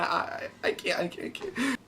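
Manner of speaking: soft, breathless, scared whisper; whispered panic. Retold or imitated. Pleading with Stephanie to leave, in a scared whisper. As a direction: barely get the words out, too scared to go on.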